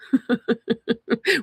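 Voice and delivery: real high, rapid giggle; light run of high laughter; amused chuckle. Real, not acted. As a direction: chuckle at your own joke. Joking about deadlifting a suitcase.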